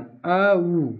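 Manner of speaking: अ and उ as two separate sounds; अ, उ broken into two vowels; split au sound. Shown as a demonstration. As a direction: say अ then उ, pulling औ apart. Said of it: "औ की तरह उच्चारण".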